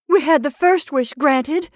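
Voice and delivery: high, loud, eager insistence; overexcited, urgent reasoning; feverish. A narrator's reading. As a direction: argue feverishly, pitch climbing. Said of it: "said the old woman feverishly".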